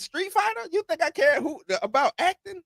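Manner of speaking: incredulous. Real, not acted. Mocking a friend's praise of the acting, incredulous and high. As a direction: scoff at the very idea, pitch leaping up. Scoffing at acting in Street Fighter.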